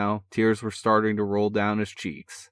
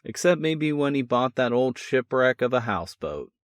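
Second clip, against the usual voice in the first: plain reading voice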